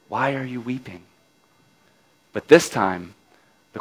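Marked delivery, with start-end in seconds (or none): 0.1-1.0 very soft and gentle, falling; soft question. A narrator's reading. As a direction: ask it tenderly, almost under the breath. Second asking, a different voice.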